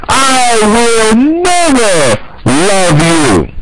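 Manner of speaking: high, slow "I will never love you", NEVER drawn out; defiant movie heroine. Acted. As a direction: cry it out like a girl refusing the villain. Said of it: "the girl will always say".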